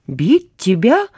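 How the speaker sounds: slow, drawn-out disbelief; surprised. A narrator's reading. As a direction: ask it slowly, amazed. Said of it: "удивилась Мила".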